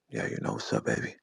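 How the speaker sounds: very low, quiet sweet-talk imitation; mock his smooth phone voice; put-on deep, soft come-on; [name]'s dropped voice. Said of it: "You dropped your voice".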